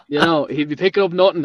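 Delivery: ordinary talk, nothing marked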